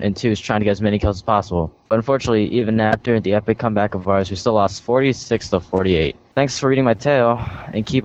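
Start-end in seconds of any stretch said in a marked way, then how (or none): none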